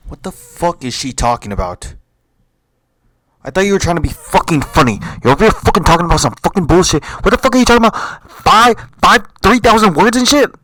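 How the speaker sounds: loud angry yelling at the video; furious rant. Real, not acted. Yelling at the comedy clip on screen, furious. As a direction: rage at a comedian's bad joke.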